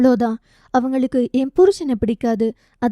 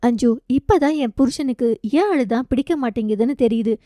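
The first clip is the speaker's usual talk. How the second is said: usual speaking voice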